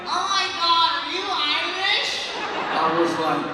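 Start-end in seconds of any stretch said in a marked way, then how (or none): 0.1-2.3 put-on higher, louder drawn-out tipsy girl voice; drunk girl. Drunk girl at the bar gushing, high loud tipsy voice. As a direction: gush like a tipsy fan. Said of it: "she's like".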